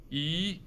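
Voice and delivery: "一" as rising second tone yí. Shown as a demonstration. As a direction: lift "一" upward, second tone. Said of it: "一 has three tones".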